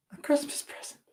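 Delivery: breathless whispered words. Real, not acted. Principal moved by boy's gift, hushed whisper. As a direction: let it fall to a whisper. Emotional story climax.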